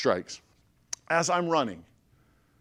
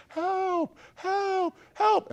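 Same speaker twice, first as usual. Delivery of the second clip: very high, drawn-out cries at moderate volume; mock scream for help; 3 dB louder, much slower than usual. Trapped rookie calling from the grave, mock screamed cries for help. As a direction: imitate desperate screaming way up high. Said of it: "I'm screaming at the top of my lungs".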